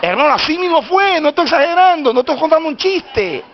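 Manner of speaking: high, insistent preaching at full force; prédica exaltada. Real, not acted. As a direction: press the truth of it on the congregation. (prédica insistente, con voz aguda)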